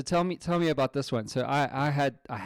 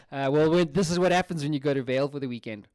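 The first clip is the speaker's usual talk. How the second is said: normal talking voice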